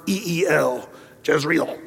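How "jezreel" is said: Jezreel in two syllables, "JEZ-reel"; English pronunciation of Jezreel. Shown as a demonstration. Preacher showing his congregation the English way to say Jezreel. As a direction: start "Jezreel" with an English J sound. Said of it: "That's the way we do".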